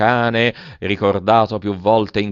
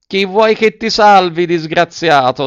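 high, loud voice like an untuned guitar; cracked, out-of-tune voice; 8 semitones higher, 6 dB louder than usual. A narrator's reading. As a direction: call out in a high, cracked creature voice. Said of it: "una vociaccia fessa di chitarra scordata".

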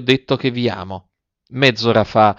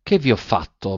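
ordinary delivery

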